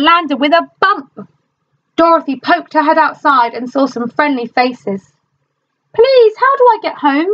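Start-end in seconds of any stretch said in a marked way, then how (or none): 5.9-7.3 put-on high, pleading little-girl voice. A narrator's reading. Dorothy asking friendly strangers the way home, high and pleading. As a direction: ask it high and hopeful. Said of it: "she asked".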